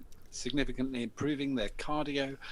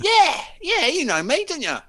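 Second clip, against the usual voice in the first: much higher and louder, wheedling; pushy young lad; 11 dB louder than usual. Retold or imitated. Lad pressing the stranger in a suit, high, loud and wheedling. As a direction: squeal it up like a pushy kid. Lad insists he knows him.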